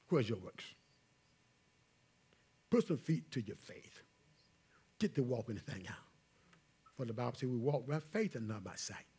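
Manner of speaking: low, soft closing exhortation; hushed preaching. Real, not acted. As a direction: drop low and soft to press the point home.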